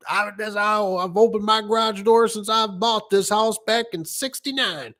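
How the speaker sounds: put-on high, slow, trembling old man; a high quavering old-timer; old customer voice.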